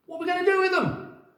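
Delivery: high, loud, quick animal voices; excited animals. Acted. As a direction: ask eagerly. Animals ask.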